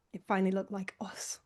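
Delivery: soft whisper. Real, not acted. Host on his rebrand, whispered. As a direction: breathe it out quietly, moved. Quiet emotional reflection.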